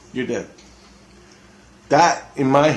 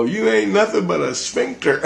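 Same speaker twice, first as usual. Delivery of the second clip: much higher, sneering insult; mocking insult; 10 semitones higher than usual. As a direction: hurl the insult up high. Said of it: "And I go".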